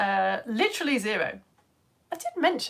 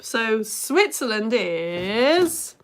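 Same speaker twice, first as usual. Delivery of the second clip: very slow build-up, "is" drawn out and rising; suspenseful drawn-out "is", left hanging; about to reveal it; 4 dB louder, much slower than usual. Pretend. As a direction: stretch "is" like a mock big reveal. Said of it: "she says like she's gonna reveal it".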